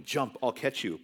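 normal talking voice

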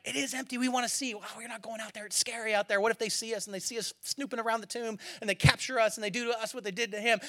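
acted nervous argument, kept very quiet; hushed worried debate. Disciples hiding from the crowds, hushed and worried. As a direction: keep it down so nobody outside hears.

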